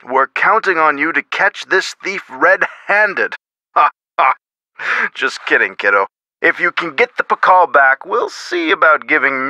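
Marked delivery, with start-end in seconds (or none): 3.7-4.3 short, separated joke laugh; brief put-on chuckle; joking laugh. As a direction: give two brief ha's, a pause between. Joking laugh before "just kidding.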